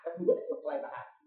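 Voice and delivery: softly whispered repeat of the phrase; hushed whispered echo. Shown as a demonstration. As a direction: drop to a breathy whisper and echo it.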